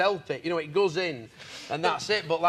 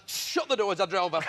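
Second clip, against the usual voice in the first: ordinary talk, nothing marked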